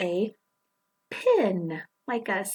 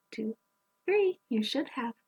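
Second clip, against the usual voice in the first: usual speaking voice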